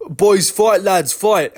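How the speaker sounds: hammed-up call to restart the fight; Mark Goddard restarting. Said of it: "he's like".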